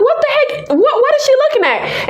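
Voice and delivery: high-pitched bewildered outburst; baffled reaction. Retold or imitated.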